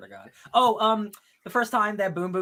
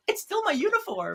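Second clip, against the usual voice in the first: high mock voice; 13 semitones higher, 3 dB quieter than usual. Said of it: "It's like".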